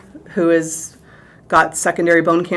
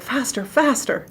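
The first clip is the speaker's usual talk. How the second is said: hushed, higher-pitched urging; urgent stage manager; 6 semitones higher, 6 dB quieter than usual. Retold or imitated. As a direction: mimic a stage manager hurrying the crew, kept soft. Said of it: "the stage manager's like".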